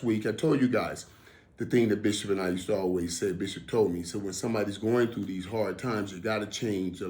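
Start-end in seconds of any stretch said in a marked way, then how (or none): none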